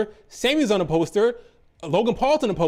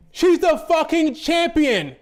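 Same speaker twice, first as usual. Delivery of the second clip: higher, louder, slow and emphatic; fed-up fan rant, each word pushed out slowly; exasperated shout; 7 semitones higher, 3 dB louder, slower than usual. Real, not acted. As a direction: hammer home that she's champion. Ranting at fans.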